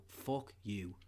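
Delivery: low, soft, deadpan curse; quiet contempt. Acted. Telling friend which biscuit offends him most, quiet contempt. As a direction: swear under your breath at it. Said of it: "you just go".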